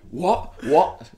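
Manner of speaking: imitated outrage, high sharp questions; riled disbelief. Riled rival manager challenging the winker, mock outraged.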